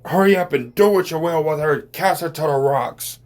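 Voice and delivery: imitating a scheming movie father urging his son on; quoting the Green Goblin scene in a mock villain voice. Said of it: "he said".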